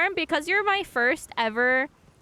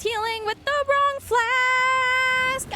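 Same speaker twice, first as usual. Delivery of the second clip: very high, slow whine; high whiny wail; 12 semitones higher, 4 dB louder, slower than usual. Real, not acted. Dismayed high wail at mistake.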